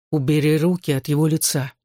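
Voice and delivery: plain reading voice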